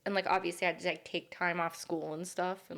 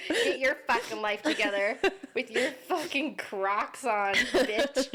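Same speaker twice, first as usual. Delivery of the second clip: laughing insult; 4 semitones higher, slower than usual. Pretend. Mock insult to teacher, laughing.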